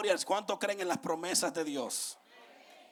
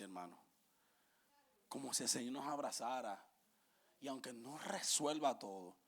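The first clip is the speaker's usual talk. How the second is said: much softer and lower, almost murmured; soft confiding preacher voice; hushed, tender testimony; 7 semitones lower, 8 dB quieter than usual. Acted. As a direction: draw them close and speak of God's embrace gently.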